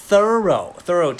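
thorough thorough, very slow, two syllables each; slow thorough twice. Shown as a demonstration. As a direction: spell out thorough slowly, twice. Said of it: "สองพยางค์ครับ". (thorough ซ้ำสองครั้ง ช้ามาก สองพยางค์)

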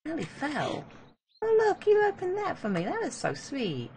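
put-on sing-song baby talk to a little creature; cooing at a pet. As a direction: coo at it like a cute pet. Coos at an in-game creature.

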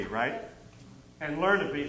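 ordinary talk, nothing marked

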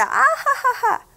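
high mocking ha-ha-ha; mocking laugh. Shown as a demonstration. Teacher showing a child what laughing at sounds like, mocking laugh. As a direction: laugh at someone, jeering. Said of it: "So laughed at, 비웃다".

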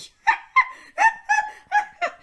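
very high, loud cackle; maniacal laugh. Acted. Doing the Joker, shrill loud cackle. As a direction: cackle like a cartoon villain. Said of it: "laugh like the Joker".

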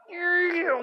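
sharp, loud, high startled cry; startled whoa. Real, not acted. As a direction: yelp in surprise. Sudden plane jolt in game.

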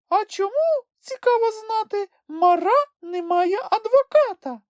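high-pitched, unrelenting challenge; persistent Sniff. A narrator's reading. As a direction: keep pressing in a high little voice. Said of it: "не вгамовувався Чмих".

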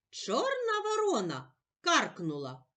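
gentle sing-song poem reading; poem for children. Acted. Teacher to child, reading Первый сніг in a gentle recital voice.

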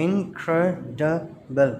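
slow "incredible" in four separate beats; syllable by syllable. Shown as a demonstration. Teacher counting syllables for children, "incredible" slowly split in four. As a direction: break "incredible" into in, cre, di, ble with pauses. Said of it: "four syllables".

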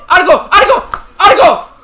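rising, loud repeated call; urgent shouting. Real, not acted. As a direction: yell for the shot, building. Urges a shot at goal. (gritos urgentes pidiendo el tiro)

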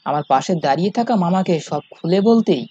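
usual speaking voice

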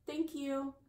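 usual speaking voice

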